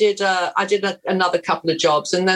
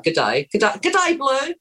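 fake Australian accent; 7 semitones higher than usual. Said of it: "is that an authentic Australian accent?".

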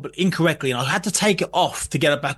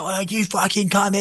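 mock shout. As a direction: stretch it into a mock holler.

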